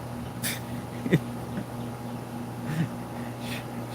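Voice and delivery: high airy giggle, no voice; real laugh at the joke; breathy laughter. Real, not acted. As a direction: silent wheezing laugh.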